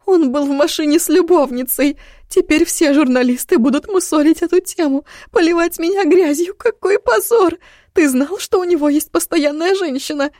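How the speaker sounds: high, loud hysterical woman's voice; shrill outburst of a shamed wife; hysterical mother. Acted. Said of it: "Голос её звучал истерично".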